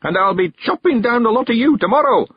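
put-on high, loud old man's crow of victory; old man threatening his garden weeds, triumphant shout. A narrator's reading. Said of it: "he shouted triumphantly".

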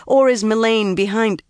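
accusing. A narrator's reading. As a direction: press the question until interrupted. Angry question cut off mid-quarrel.

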